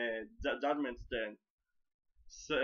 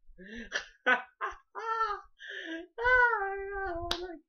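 real laugh; 4 dB louder than usual. Real, not acted. Laughs at his own retelling.

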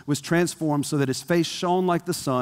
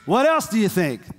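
raised-pitch follow-up question; eager asking; 6 semitones higher than usual. Acted. Believer asking God for more, eagerly.

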